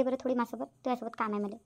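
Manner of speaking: low, soft words meant only for her; hushed aside. Acted. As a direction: keep it out of the daughter's hearing. Father speaks privately to mother. (वडील आईला हळू, दबक्या आवाजात सांगतात)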